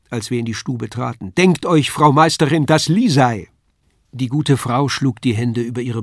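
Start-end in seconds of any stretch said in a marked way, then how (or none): 1.3-3.5 high, loud eager call; excited announcing. Acted. Paul tells the landlady Lisei has come, excited. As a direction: announce her arrival with delight.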